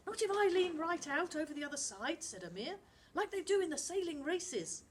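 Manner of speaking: a lad sure of his plan; eager boy voice. Acted. Amir to Charlie in the dinghy, keen and high.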